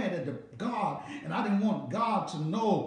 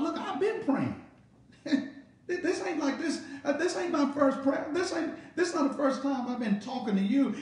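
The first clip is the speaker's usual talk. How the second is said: much higher, rising pleas with a laugh; pleading outcry to God; 6 semitones higher than usual. Retold or imitated. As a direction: cry up at God, impatient and pleading. Preacher voicing impatient believer's prayer.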